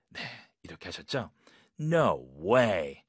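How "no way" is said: low, sceptical "no way"; disbelieving. Shown as a demonstration. Teacher modelling answer to Everest claim, disbelieving. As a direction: say it as if you don't believe them. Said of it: "약간 I don't believe you 이런 느낌이죠".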